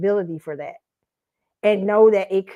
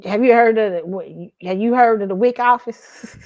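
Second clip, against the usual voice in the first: ordinary talk, nothing marked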